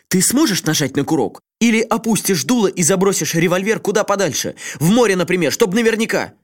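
high, heated pleading rant; worked up. A narrator's reading. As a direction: build the argument into fury. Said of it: "разошёлся не на шутку". (разгорячённо, всё громче)

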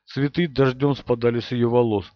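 ordinary delivery

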